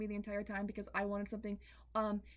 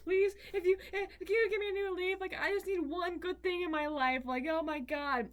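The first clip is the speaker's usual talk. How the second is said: higher, louder, desperate begging; desperate plea; 7 semitones higher, 4 dB louder than usual. Retold or imitated. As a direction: beg the plant for one good thing. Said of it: "I was like".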